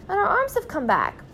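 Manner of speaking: very high, amazed exclamation; delighted cry. A narrator's reading. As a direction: let the voice soar with relief. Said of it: "cried Mister Gregg".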